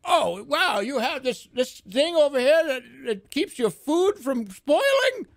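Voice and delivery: wide-eyed alien marvelling at a calendar; awed clueless alien. Retold or imitated. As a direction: gawk at every human gadget. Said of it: "Aliens could just be like".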